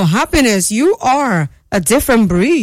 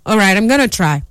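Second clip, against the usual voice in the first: ordinary talk, nothing marked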